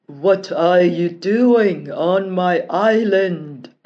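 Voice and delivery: loud, slow monster voice; fierce monster roar. Acted. Monster confronting the prince on its island, loud slow roar. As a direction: roar it out of the bush.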